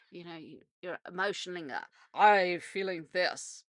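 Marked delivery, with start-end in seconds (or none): 2.2-3.6 lightly imitated politician's speech, drawn out; slow, stretched, emphatic speech-making; Bill Clinton. Showing how a president anchored emotion in speeches, slow mock oratory. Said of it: "Bill Clinton used to do his speeches that way".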